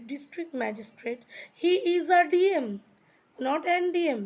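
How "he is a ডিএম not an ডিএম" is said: "he is a DM" said slowly and louder; slow model sentence. Shown as a demonstration. Teacher drills students on "a DM", slow and clear. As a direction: stretch out each word of the example. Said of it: "DM যদি বলি".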